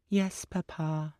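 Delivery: high, slow, solemn; a little girl promising; small girl voice. A narrator's reading. Little girl promises her father, slow solemn child voice.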